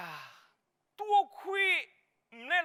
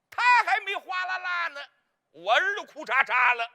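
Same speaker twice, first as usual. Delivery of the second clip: louder comic punch; punchy punchline; 4 semitones higher, 7 dB louder than usual. Acted. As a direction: land the gag hard.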